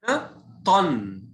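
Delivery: طن ending in a held n sound; slow model of طن with a humming n; tanween. Shown as a demonstration. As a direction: draw out the final noon of طن. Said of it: "كأن نطقت نون مكان الفتحتين".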